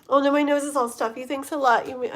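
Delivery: normal talking voice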